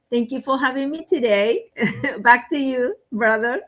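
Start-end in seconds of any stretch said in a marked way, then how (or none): none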